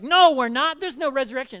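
high, loud contradiction; heated crowd retort, easing at the end; crowd arguing back. Acted. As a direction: shout down the other side. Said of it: "some of them would be like".

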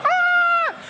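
high, loud squealing scream; girlish scream. Retold or imitated. Kid recalling his screams, high loud squeal. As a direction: let out a thrilled shriek. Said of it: "I screamed like a girl".

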